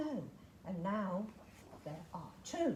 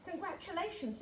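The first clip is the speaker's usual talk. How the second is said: ordinary delivery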